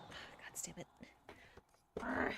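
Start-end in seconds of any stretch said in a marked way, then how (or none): 0.1-0.9 breathy under-breath curse; whispered curse. Real, not acted. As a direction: swear quietly to yourself. Frustrated at screen.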